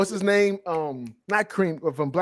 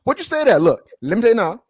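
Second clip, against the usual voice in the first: high, louder Louisiana accent; thick Memphis-Louisiana accent; 6 semitones higher, 5 dB louder than usual. Shown as a demonstration. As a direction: let the hyped-up Memphis-Louisiana talk out. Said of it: "it's very Memphis, Louisiana".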